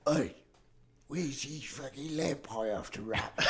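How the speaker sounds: very soft, low mumbled cockney; mock Statham mumble. Doing Jason Statham as Aladdin in a very quiet mumble. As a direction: mutter it like a quiet hard man. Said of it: "He's like".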